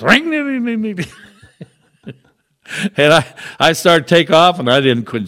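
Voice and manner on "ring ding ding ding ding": high ringing mimic of a rattling diesel motor; mock engine ringing, pitched well up; imitated engine noise. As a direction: play the motor, a high sing-song ring, ding, ding. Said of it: "the motor goes".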